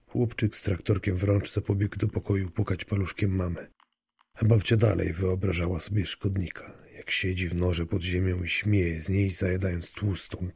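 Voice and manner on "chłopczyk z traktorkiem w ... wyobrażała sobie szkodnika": ordinary delivery, nothing marked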